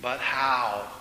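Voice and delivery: subdued, helpless question, falling; a touch of weary dejection; mild moan. A narrator's reading. Man asking God how to love them, moaning mildly. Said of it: "I moaned".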